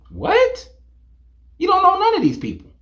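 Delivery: high-pitched disbelieving what; stunned, high-pitched disbelief; incredulous. Real, not acted. Incredulous reaction.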